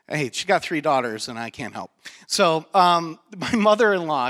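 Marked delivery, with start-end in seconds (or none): none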